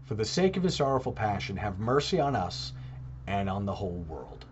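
soft, reverent recited prayer. Acted. Reciting the Chaplet as an example of praying, soft and devout.

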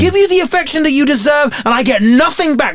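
high-pitched melodramatic rant; soap opera tirade. Acted. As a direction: pour out grievances, high and overwrought. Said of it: "soap opera way".

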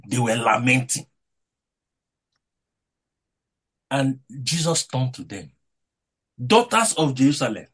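rising, fervent preacher's voice with loud peaks; preaching. Acted. Pastor exhorting the brethren about Luke twenty-three, fervent preaching. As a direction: preach it to the congregation with conviction. Preacher delivering his sermon.

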